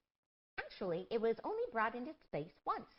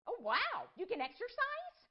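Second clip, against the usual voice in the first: surprised; 13 semitones higher than usual. Real, not acted. Surprised question.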